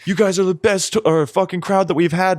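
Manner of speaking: high, cheesy stage flattery; imitating a frontman. Podcaster mocks a singer's repeated crowd praise, high cheesy voice. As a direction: lay on the fake crowd praise. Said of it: "It's like".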